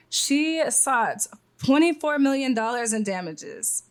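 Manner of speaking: normal talking voice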